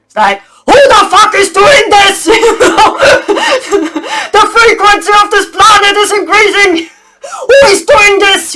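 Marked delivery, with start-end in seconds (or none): 0.7-2.3 high, loud furious demand; angry yell. Retold or imitated. Speaker voices the angry dracos hunting the culprit, shouting. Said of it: "they were super angry". 4.3-8.6 higher and louder outraged demand; angry shout. Retold or imitated. Speaker voices the angry dracos questioning the planet, shouting. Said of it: "they were super angry".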